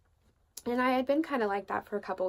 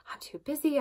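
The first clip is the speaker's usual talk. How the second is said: higher-pitched pretend protest; mock excuse; 7 semitones higher, 4 dB louder than usual. Imagined friend refusing the Spirit's prompting, mock excuse. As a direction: put on a harried brush-off.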